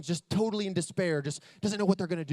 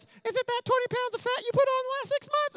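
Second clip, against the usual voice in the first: much higher, teasing put-on voice of his wife; mock wife voice. Retelling his wife's jab about his weight, high mocking voice. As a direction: squeak it out like a sassy wife poking fun. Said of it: "And then she goes".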